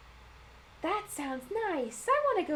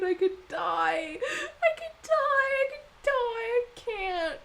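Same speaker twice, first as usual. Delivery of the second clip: slow, much higher crying voice; tearful gushing; 10 semitones higher, 5 dB louder, much slower than usual. Real, not acted. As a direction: sob it out, overcome with joy. Overcome by cute character.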